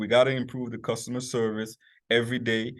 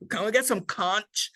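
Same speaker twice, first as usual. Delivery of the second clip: eager tourist; 9 semitones higher than usual. Retold or imitated. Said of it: "They call it conch".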